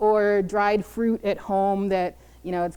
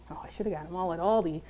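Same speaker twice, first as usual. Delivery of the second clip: rueful aside; 3 semitones lower, 7 dB quieter, faster than usual. Real, not acted. Quick regretful aside.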